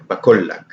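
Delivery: bakullak with the qaf far back in the throat; qaf pronunciation. Shown as a demonstration. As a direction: say bakullak with a deep-throated q sound. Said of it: "the kaf is farther back in the throat".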